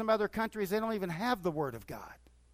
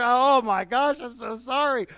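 high, louder retold flustered sorry; mock apology; 6 semitones higher, 9 dB louder than usual. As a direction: act out the startled apology to the man behind. Apologizing after hitting someone.

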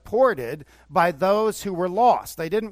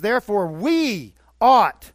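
louder, slow, fired-up "Therefore, we ought"; excited preaching; 5 semitones higher, 9 dB louder, slower than usual. Retold or imitated. John excitedly urging believers, loud and slow. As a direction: hammer it like a Southern Baptist preacher. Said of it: "He was getting excited with them".

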